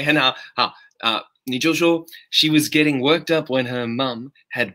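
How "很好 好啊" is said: normal talking voice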